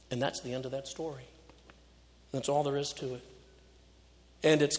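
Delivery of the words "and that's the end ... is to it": ordinary talk, nothing marked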